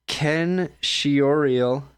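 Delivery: slow, unsure sounding-out of Shioriel. Shown as a demonstration. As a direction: stretch Shioriel syllable by syllable. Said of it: "This part I'm not".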